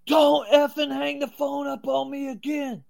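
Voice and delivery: imitating an exasperated caller, high and loud; retold angry yell. Producer scolding the man who keeps hanging up, retold angry yell.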